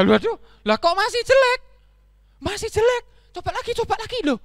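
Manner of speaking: high, loud exasperated squeal; squeaky donkey, dismayed and urgent; upset disbelief. Acted. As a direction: cry out in frustration. Donkey dismayed, tries again.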